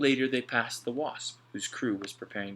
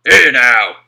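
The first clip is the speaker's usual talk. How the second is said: much louder, sharp rebuke; Slank, sharp challenge; 3 semitones higher, 23 dB louder than usual. A narrator's reading. Slank catching Alf at the trunk, loud rebuke. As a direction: stop him in his tracks.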